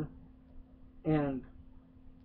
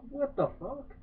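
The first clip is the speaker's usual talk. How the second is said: much higher, incredulous yelp; high startled disbelief; shocked reaction; 9 semitones higher, 4 dB quieter than usual. Retold or imitated. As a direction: react like you can't believe what you saw. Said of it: "And I'm like".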